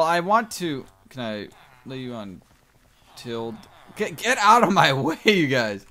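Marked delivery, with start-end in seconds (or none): 4.0-5.8 loud laughing. Real, not acted. Shoving villagers out of way.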